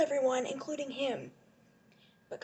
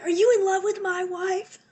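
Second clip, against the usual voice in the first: loud, higher-pitched mock confrontation; hammed-up outraged husband, raised voice; indignant husband; 6 semitones higher, 8 dB louder than usual. Acted jealous husband confronting Steven.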